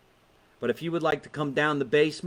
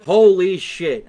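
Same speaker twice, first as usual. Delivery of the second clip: loud, slowed-down disbelief; loud slow exclamation; 6 semitones higher, 12 dB louder, slower than usual. Real, not acted. Host reacting to the president's climate remark, loud slow disbelief.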